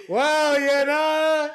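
mock stunned Sid. Host teasing co-host over his fake shock, slow mock amazed whoa. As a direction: imitate a friend faking amazement at a big win. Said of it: "He's like".

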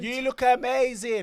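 high, loud, drawn-out mock squeal; fake gushing women. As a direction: imitate women showering each other with fake praise. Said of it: "there is nothing faker than women linking up".

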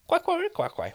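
very high quacking duck noise; animal chorus. Acted. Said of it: "Your, your dialect is inc".

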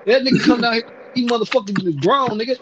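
usual speaking voice

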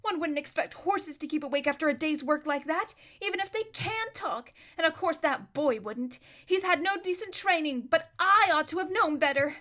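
high, loud, irritated self-scolding; furious muttering. A narrator's reading. Aravis angrily scolds herself while rousing the others. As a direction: scold yourself for oversleeping. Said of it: "she said to herself furiously".

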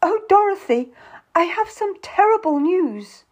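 high, dramatic lament; tearful. A narrator's reading. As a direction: wail as the bearer of awful news. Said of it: "she says tearfully".

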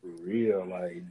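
normal talking voice

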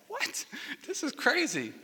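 high, squeaky disbelieving yelp, then a laugh; a kid gasping at a crazy order; mock disbelief breaking into laughter; shocked. Retells his childhood shock.

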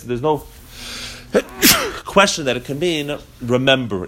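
ordinary talk

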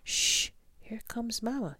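loud shush, then a high whispered warning; hissed. A narrator's reading. Baby bird warning siblings mama is coming: high hissed whisper. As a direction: hush the others before mama hears. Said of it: "hissed Pip".

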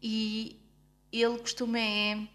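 usual speaking voice

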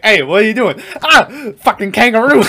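high, loud mock yell at the kangaroo; startled yell. Parachuting guy yelling at a kangaroo, high mock shout. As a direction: shout it up high like you're being attacked. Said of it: "And he's like".

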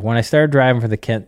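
ordinary delivery

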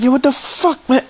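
very high-pitched exasperated cry; angry outburst. Retold or imitated. Customer told he gets no package, angry outburst. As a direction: re-enact losing it, pitch shooting up. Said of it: "So I really got angry".

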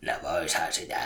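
low, hushed burglar's reply; murtautuja. A narrator's reading. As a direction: answer low, half under your breath. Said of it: "murtautuja vastasi". (murtautujan matala, hiljainen vastaus)

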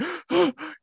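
imitated sharp, high gasp for air; sobbing gasp. Client failing to breathe as counselor urges him, imitated sobbing gasp. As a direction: suck in air as if hyperventilating. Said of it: "I'm like".

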